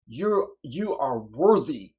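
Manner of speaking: slow, loud mock-earnest proclamation in an exaggerated voice; mock a senator's slow, loud, overwrought delivery; Cory Booker impression. Host mocking senator's tearful praise, exaggerated impression. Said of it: "he talks like this".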